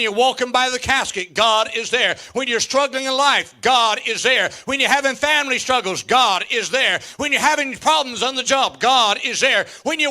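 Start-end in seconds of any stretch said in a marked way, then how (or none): none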